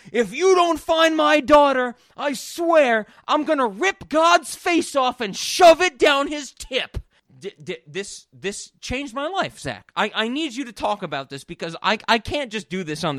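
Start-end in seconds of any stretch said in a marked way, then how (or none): 0.1-7.0 high, loud desperate father's threat; frantic dad yelling. Retold or imitated. Podcast host acting out the frantic dad's threat, loud yell. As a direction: scream it like a panicking dad. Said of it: "the dad is like".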